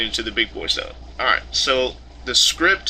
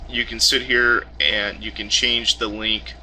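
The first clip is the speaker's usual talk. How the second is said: ordinary talk, nothing marked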